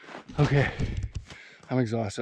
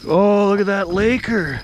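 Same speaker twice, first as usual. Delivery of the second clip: excited; 8 semitones higher, 9 dB louder than usual. Real, not acted. Catching the first fish, elated.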